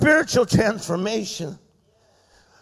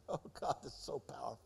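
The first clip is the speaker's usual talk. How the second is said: breathy whispered aside after a laugh; awed whisper; 11 semitones lower, 26 dB quieter, faster than usual. Real, not acted. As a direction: whisper it to yourself in awe.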